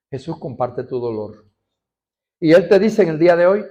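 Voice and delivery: ordinary delivery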